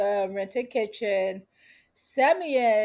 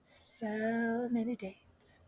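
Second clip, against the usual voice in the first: slow, much softer, lingering on "many"; mock dreamy repeat of the dates; dreamy drawn-out echo; 14 dB quieter, much slower than usual. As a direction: savor "So many dates" slowly and softly. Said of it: "Megan was like".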